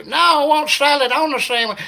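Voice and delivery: mock hillbilly ordering through the speaker. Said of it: "redneck guy".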